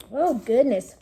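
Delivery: ordinary delivery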